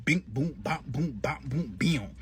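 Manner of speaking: punchy cartoon fight noises; mock comic-book punches; fight sound effects. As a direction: smack out each hit like a cartoon brawl.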